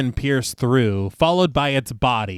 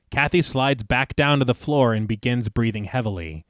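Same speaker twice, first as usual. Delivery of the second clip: ordinary delivery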